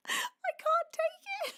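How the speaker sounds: high, slow tearful squeak; choked up, barely getting it out; overwhelmed crying. Real, not acted. Overwhelmed by gift subs.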